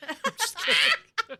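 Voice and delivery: loud high laugh, slow; real burst of laughter. Real, not acted. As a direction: crack up mid-apology. Laughing after joke.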